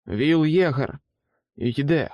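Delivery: a narrator's restrained call out; attempted shout. A narrator's reading. Exhausted man tries calling into the jungle, restrained shout. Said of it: "Він знову спробував крикнути".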